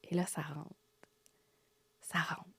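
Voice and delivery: chuchote, plus bas, comme une révélation; murmure émerveillé. Retold or imitated. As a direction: baisse la voix sur le moment magique. Moment mystique raconté. (chuchotement émerveillé)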